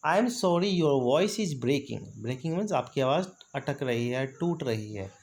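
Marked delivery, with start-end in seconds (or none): 0.1-2.0 slow, careful delivery; drawn-out example sentence; slow model phrase. Shown as a demonstration. Teacher showing students a bad-line phrase, slow. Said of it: "next example देखिएगा".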